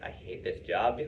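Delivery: low, soft mock grumble; imitating your own sullen griping; retold grumble. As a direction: mutter a remembered complaint under your breath. Quotes own complaint about old job.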